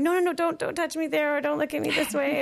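high-pitched mock-panicky objection; flustered protest. Acting out refusing lover's touch.

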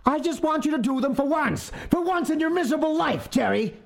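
roaring back. A narrator's reading. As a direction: spit it out in anger. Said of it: "a woman roared back".

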